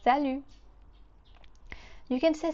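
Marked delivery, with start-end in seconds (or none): 0.0-0.4 salut ending on the vowel, T silent; French salut, no T. Shown as a demonstration. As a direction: say salut without the final T. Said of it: "we don't hear the T here".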